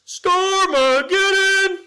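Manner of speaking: high loud blurt; trombone-style blurt. Pretend. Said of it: "You tried your own trombone there".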